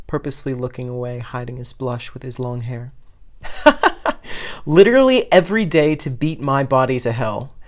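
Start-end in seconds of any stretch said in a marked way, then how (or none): 3.0-4.6 breathy burst of high laughter; laughing fit. Acted. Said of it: "between laughing fits". 4.7-7.5 words forced out loud through laughter; laughing while talking. Acted. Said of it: "Reki says between laughing fits".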